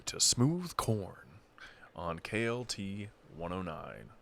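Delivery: slow, smooth radio DJ station ident. Pretend. Host plays a smooth radio DJ announcing the station. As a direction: croon the station name like a smooth-jazz DJ. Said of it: "there we go".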